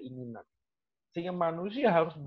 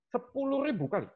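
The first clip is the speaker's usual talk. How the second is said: stunned echo of the number, pitched up; shocked; 8 semitones higher than usual. Real, not acted. Lecturer repeating a student's claim, shocked. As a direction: repeat it in disbelief. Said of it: "Come on, man, I'm shocked".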